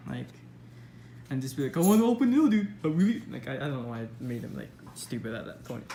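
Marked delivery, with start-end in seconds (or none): 1.8-3.2 loud, higher dopey voice, lightly played; dumb captain voice. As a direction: play the captain as a clueless dude. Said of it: "super stupid".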